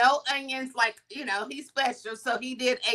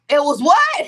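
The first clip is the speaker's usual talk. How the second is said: retold disbelief; 8 dB louder than usual. Friend reacts in disbelief.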